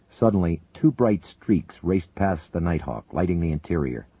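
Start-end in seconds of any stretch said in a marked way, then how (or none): none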